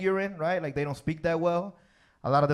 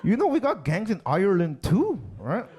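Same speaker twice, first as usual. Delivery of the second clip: a hint of an Irish accent, lightly done; Irish accent attempt; 3 semitones higher than usual. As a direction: try a loose Irish lilt on the quote. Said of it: "I'm gonna try for you guys".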